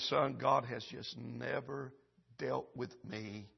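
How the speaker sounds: slow fading whisper of regret; hushed grandmother. Retold or imitated. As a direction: let her confession die away. Dying grandmother's last words.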